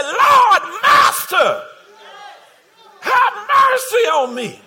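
high, loud, drawn-out plea; loud cry for mercy. Acted. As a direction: call out desperately for mercy. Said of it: "He got loud".

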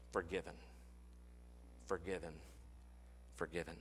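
hushed, tender repeats of forgiven; soft repetition. Acted. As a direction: let the word fade away as you repeat it. Repeating word to write down.

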